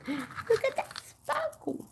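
gasp, then high, drawn-out wonder; hammed-up high-pitched marvelling; exaggerated awe. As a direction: play up the amazement in a slow high squeal. Gasps, repeats it exaggeratedly.

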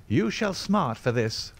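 slow, high-pitched threat; drawn-out angry outcry; enraged cry. A narrator's reading. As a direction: threaten them in indignant rage.

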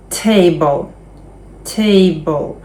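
TAble, stressed on TA, final e silent; stress on first syllable. Shown as a demonstration. Teacher modelling table for the class to repeat. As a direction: model the word table slowly. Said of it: "Наголос на «a»".

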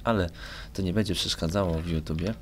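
ordinary delivery

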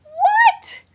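What shrieked very high and loud; a squeal of disbelief; shocked what. Retold or imitated. Finding no sound on the video, shrieked What. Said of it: "I was like".

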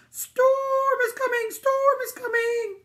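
high, slow, loud sheep voice; drawn-out, alarmed sheep cry; bleating sheep. A narrator's reading. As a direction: stretch the vowels into a bleat. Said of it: "the sheep bleated".

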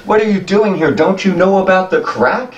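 put-on high urgent old goblin voice; old goblin questioning the strangers, high and urgent; old goblin asking. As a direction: press the strangers in a high, worried voice. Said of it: "he says".

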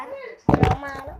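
loud shout of coming; calling out. Real, not acted. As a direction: shout it back across the room. Calling out while busy filming. (ตะโกนตอบเสียงดัง)

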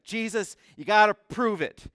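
loud, high mocking challenge; taunting demand. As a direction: throw the dare at him loudly.

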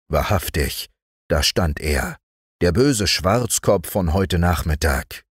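usual speaking voice

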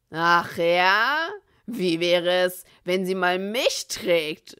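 loud, slow mockery; mocking threat. A narrator's reading. Bill taunting Andy about his unicorn, loud and slow. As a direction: taunt him.

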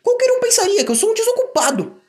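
resmungo agudo e emburrado; Percy resmungando. Acted. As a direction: reclama como locomotiva mal-humorada. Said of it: "resmungou Percy". (Percy resmunga com Toby, voz aguda e irritada)

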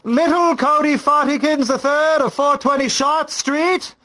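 high-pitched parental summons, full name drawn into a call. Acted. Parent calling child down for a talk, calling out. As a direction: call a kid down from their room.

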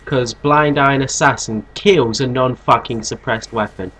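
usual speaking voice

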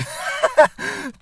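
high burst of laughter; real laugh. Real, not acted. Storyteller amused by the barman's rant, laughing high. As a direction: crack up at your own impression.

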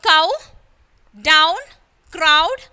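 cow, down, crowd with a loud "ow" glide; OW as in how. Shown as a demonstration. Teacher reading the how list to pupils, OW demo. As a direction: model cow, down, crowd one at a time, rhyming with how. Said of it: "pronounced as in how".

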